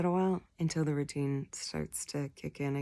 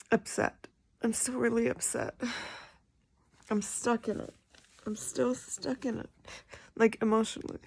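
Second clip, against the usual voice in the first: tearful; 5 semitones higher than usual. Real, not acted. Upset over ex, voice breaking.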